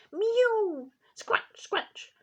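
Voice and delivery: very high, loud mew; a cat crying to be let in. A narrator's reading. Simpkin mews at the shop door, high and loud. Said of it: "scuffled Simpkin".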